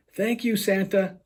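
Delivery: calling up. Acted. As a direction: call out to Santa upstairs. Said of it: "she calls up the chimney".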